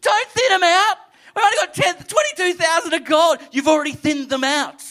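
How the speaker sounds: high, loud panicked protest; mock-frantic begging; hammed-up high panic; panicked Gideon. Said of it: "this Gideon's like".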